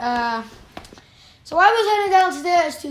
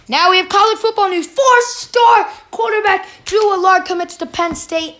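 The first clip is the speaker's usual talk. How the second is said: high, loud news announcement; excited, raised-pitch sports headline; 5 semitones higher, 5 dB louder than usual. Real, not acted. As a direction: brag about it with real excitement. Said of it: "I just was excited".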